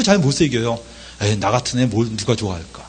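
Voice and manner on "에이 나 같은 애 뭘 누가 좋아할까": low, soft self-dismissal; self-deprecating. Acted. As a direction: sink into quiet self-doubt, acted. Voicing child with inferiority complex.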